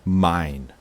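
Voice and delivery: a loud, cutting answer; loud reveal. Acted. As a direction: throw the answer at them. Revealing she gave her life.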